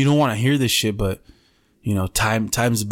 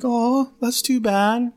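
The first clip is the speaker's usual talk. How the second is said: high, slow put-on woman's coo; mock sympathy; 11 semitones higher, much slower than usual. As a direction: draw it out high, imitating her pity. Acting Susan's sympathy.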